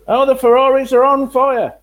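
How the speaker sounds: high, loud frantic commentary; excited race commentator. Retold or imitated. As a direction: shout it like a breathless commentator. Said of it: "start on our Murrayisms".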